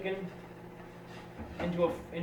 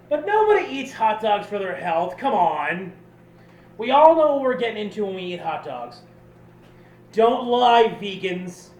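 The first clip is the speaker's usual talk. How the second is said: mock scolding; 3 semitones higher, 8 dB louder than usual. Mock-scolding hot dog critics.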